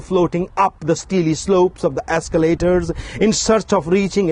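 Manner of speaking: lifted, measured recital voice; poetry reading. Acted. Poet reading his own poem to an audience, recital voice. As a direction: declaim each image to the audience. Reads poem Temple London.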